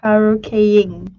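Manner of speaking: slow, loud model of karaokeing; corrective pronunciation. Shown as a demonstration.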